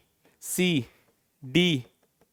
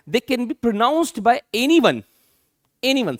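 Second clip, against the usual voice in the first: slow and louder, each word spaced; dictated slowly for the class to copy; slow emphatic dictation; 5 semitones higher, 4 dB louder, much slower than usual. Shown as a demonstration. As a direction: drive the rule home slowly. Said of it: "teacher stresses key line".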